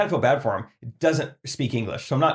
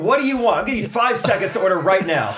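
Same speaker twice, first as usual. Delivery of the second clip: louder, higher, pushy demand; impatient waiter; 9 semitones higher, 5 dB louder than usual. Pretend. Waiter losing patience with an indecisive diner, loud. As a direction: bark at a dithering customer. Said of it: "Dude, order".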